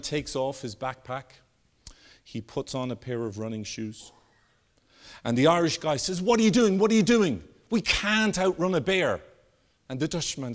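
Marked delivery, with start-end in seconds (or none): 6.2-9.2 high, louder mock panic; hammed-up alarm, pleading with a friend; freaking out. Irishman to friend changing shoes before a bear, acting frantic. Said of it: "The Irishman's freaking out".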